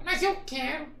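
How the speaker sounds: high puppet voice; Kleber. Acted. Puppet begging to show capoeira, high puppet voice. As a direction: insist as a high-pitched dummy. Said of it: "acha uma voz que pareça com o boneco".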